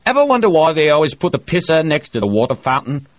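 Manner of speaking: a loud boastful spacer holding court; O'Hara voiced. A narrator's reading. O'Hara brags to the crew, loud and bluff.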